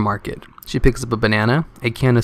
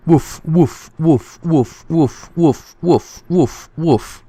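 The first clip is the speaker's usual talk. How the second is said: yappy barks, higher and louder, fading toward the end; dog barking; 5 semitones higher, 4 dB louder, much slower than usual. Acted. A dog's telegram, read out as barking woofs. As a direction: bark each woof like an eager dog.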